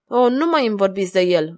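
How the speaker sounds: usual speaking voice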